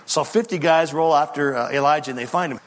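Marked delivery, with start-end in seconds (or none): none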